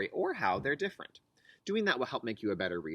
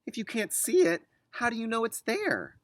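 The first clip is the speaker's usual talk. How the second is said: put-on higher, louder curious girl; child voice; 10 semitones higher, 4 dB louder than usual. Liza questioning mom about the string, childlike voice. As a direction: ask like a doubtful little girl.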